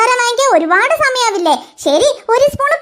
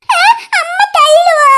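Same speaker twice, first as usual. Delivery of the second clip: scared crying question; 5 semitones higher, slower than usual. Acted. Boy scared of mother.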